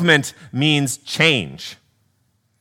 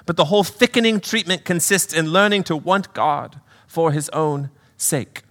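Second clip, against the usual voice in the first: earnest, raised British spirit voice; British accent; 4 semitones higher than usual. Acted. Brother pleading with his sister, raised British accent. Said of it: "do a little accent work".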